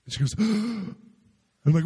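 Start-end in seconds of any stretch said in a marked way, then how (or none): 0.4-0.9 sharp, high mimicked gasp; acted gasp of revelation; imitated gasp. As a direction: gasp as if struck by a sudden idea. Retold girl's sudden revelation.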